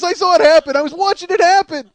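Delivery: loud, high, elated outburst; gleeful exclamation. Real, not acted. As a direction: crow about witnessing a glorious disaster. Gleefully reliving the disaster.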